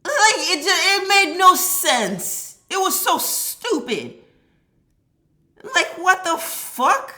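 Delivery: high, loud, drawn-out disbelief; outraged disbelief. Real, not acted. To viewers about the rude woman, loud high outrage.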